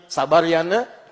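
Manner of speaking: put-on slow, gentle hush to a baby; calm the child slowly; a mother soothing her crying baby, slow and gentle.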